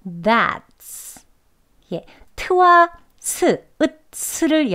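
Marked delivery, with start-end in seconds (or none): 0.1-1.2 that's with stop T joined to s; linked that's. Shown as a demonstration. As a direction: blend that's smoothly. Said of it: "이어서 발음하게 되면". (that's 연결 발음)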